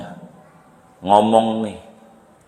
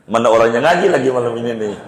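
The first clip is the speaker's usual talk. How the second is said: ordinary talk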